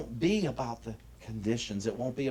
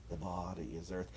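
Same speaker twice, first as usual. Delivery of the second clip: much softer, almost to yourself; quiet repeat; 4 semitones lower, 10 dB quieter than usual. Shown as a demonstration. Minister repeating the point to the congregation, much softer. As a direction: let the repeat sink in, softly.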